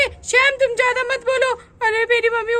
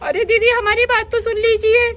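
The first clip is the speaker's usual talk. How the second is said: normal talking voice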